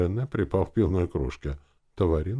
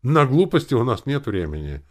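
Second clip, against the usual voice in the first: loud, higher, firm admonition; stern; 9 semitones higher, 9 dB louder than usual. A narrator's reading. Host warning the two girls against nonsense, stern. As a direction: lay it down loudly and firmly. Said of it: "сказал я строго".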